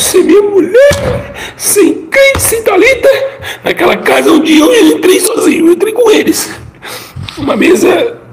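high, breaking voice through real tears; crying. Real, not acted. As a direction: sob out the loneliness, voice cracking high. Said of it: "me faz chorar". (chorando de verdade, voz embargada e aguda)

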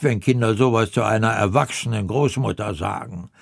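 usual speaking voice

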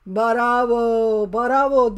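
lightly voiced friend yelling; mock shout. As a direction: shout bravo on her behalf. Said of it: "Dur ben senin yerine bağırırım".

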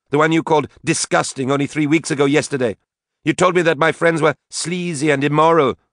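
high, loud, ranting accusation; angry. A narrator's reading. Drunken daughter rages at her mother, angry. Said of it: "said Katie angrily".

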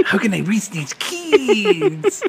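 high, slow, earnest teacher. Retold or imitated. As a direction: sigh it out like a worried teacher. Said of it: "fucking Jaime Escalante".

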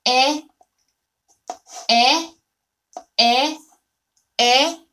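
ê held clear and loud; repeated vowel drill. Shown as a demonstration. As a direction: model the vowel ê for students. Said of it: "Their sounds are almost the same".

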